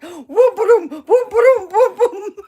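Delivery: very high, loud mocking singsong chant; mock Karen singsong. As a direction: chant it like a smug shoplifter. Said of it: "she's like".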